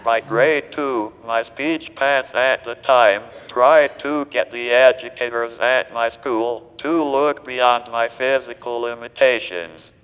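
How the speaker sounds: synthetic text-to-speech voice; digitized voice. Real, not acted. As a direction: speak like a computer speech device. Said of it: "this digitized voice just does not seem to quite".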